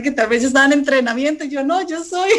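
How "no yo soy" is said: higher-pitched mock protest; retold protest. Young boss correcting buyers who took her for a trainee, high retold protest. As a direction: lift the voice to correct them.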